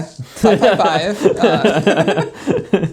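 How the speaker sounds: laughing through the digits, slow and loud. Real, not acted. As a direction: giggle the numbers out. Laughing reading silly number.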